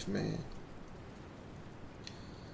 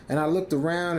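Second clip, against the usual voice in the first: plain reading voice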